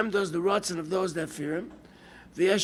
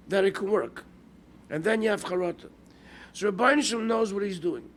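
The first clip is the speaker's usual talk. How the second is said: ordinary talk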